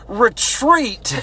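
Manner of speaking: high-pitched retold battle cry; mock yell. Girl in basic training yelling during the attack, mock dramatic yell. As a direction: call it out like a hammed-up movie soldier. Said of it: "one girl yells".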